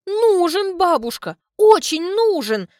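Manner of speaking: high loud tearful plea; sobbing. Acted. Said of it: "всхлипывает Альбина".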